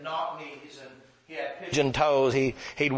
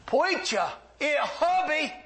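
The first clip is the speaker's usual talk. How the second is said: very high imitated older man's call; put-on high voice, calling for the preacher; Herbie; slower than usual. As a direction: call down the hall in a high, odd voice. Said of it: "I can hear him".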